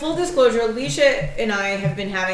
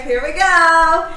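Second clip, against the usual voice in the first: mock announcement; 7 semitones higher, 6 dB louder, much slower than usual. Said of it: "announcing her own topic".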